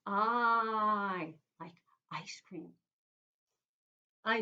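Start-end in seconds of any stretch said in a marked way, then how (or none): 0.1-1.3 "I" held as the long eye sound, a bit louder. Shown as a demonstration. Teacher to class, modelling the long i. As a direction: stretch out the long "I". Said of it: "we put our hand out like this and go".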